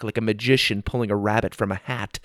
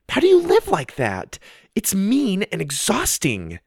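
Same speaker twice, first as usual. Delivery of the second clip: indignant outburst; 10 semitones higher than usual. Acted. Sophie confronting the club girls.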